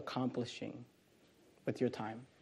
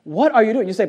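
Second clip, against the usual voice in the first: ordinary talk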